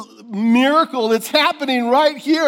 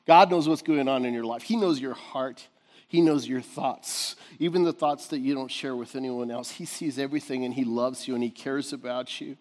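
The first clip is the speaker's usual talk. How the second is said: tender reassurance; 6 semitones lower, 6 dB quieter than usual. Real, not acted. Tender reassurance of God's love.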